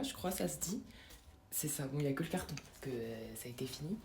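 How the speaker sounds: hushed unvoiced whisper to the viewers; confiding whisper about a snack. Real, not acted. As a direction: whisper it like sharing a secret.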